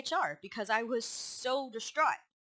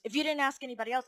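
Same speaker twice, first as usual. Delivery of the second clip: quick, higher-pitched curt retort; retold hostility; 5 semitones higher, 4 dB louder, much faster than usual. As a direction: imitate a boss interrupting impatiently.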